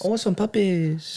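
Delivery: mock Mexican accent. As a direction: drag out the Mexican accent for laughs. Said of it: "how would I do a Mexican accent here".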